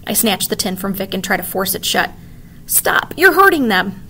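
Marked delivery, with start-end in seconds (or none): 2.7-4.0 put-on high, loud child's plea; crying out. A narrator's reading. Kavita pleading as the dragons howl. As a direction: yell it to protect them. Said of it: "Kavita cries".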